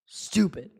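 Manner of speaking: deflated quiet aside; muttered. Real, not acted. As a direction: mutter it under your breath in disgust. Fan disgusted at team.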